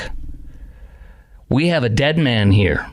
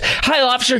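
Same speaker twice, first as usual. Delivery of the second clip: mock innocent driver; 11 semitones higher than usual. Imitating the driver's breezy greeting.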